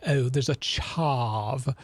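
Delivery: slow, drawn-out mock British; hammed-up posh British sneer; British accent. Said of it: "I love saying chav like that".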